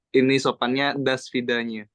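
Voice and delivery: "das vidanya" said slowly and clearly; slow teaching demo. Shown as a demonstration. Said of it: "Itu lebih sopan".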